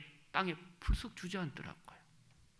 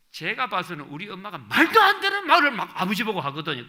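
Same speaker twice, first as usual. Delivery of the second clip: normal talking voice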